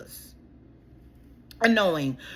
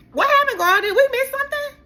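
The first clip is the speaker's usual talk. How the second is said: sassy disbelief; 14 semitones higher, 4 dB louder than usual. Real, not acted. Sassy repeat of question.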